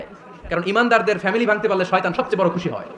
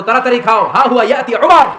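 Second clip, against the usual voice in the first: loud, high, urgent cry of warning; alarmed mothers' warning; 5 semitones higher, 9 dB louder than usual. Acted. Medina mothers scaring children into eating, loud alarmed warning. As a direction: cry out as if danger is coming.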